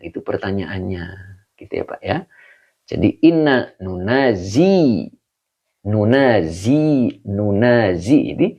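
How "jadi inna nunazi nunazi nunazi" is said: nunazi spelled out slowly, syllable by syllable; slow word demo. Shown as a demonstration. Teacher slowly models nunazi for the students. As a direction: drag out nunazi carefully for the class. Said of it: "awalan nun, akhiran doma".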